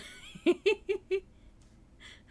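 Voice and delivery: a big delighted laugh at the chat; real amused laughter; loud high laugh. Real, not acted.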